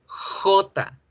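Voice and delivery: "J" said jota with a rasped throat h; Spanish consonant J. Shown as a demonstration. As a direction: model "J" as a strong Spanish jota. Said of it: "en español suena a consonante".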